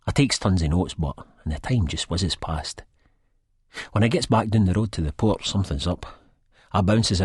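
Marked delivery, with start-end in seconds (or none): none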